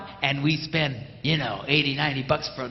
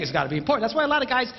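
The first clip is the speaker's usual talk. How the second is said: ordinary talk, nothing marked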